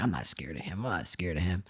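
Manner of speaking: lightly imitated Bronx bravado, low and soft; a hint of a tough Bronx girl, pitched low; low, understated mimicked defiance. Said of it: "like, a girl from the Bronx".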